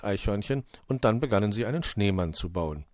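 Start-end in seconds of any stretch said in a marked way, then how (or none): none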